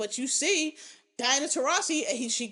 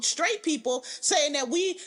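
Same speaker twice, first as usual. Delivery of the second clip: usual speaking voice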